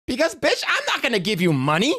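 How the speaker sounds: ordinary talk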